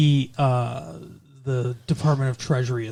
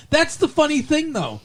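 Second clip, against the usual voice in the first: usual speaking voice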